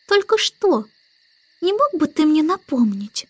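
put-on high childlike questioning; puzzled baby girl. A narrator's reading. Baby asks the starling to remind her, high and puzzled.

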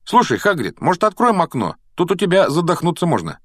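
high, stifled-by-heat plea; complaining youngster. A narrator's reading. Boy asks Hagrid for a window, high complaint.

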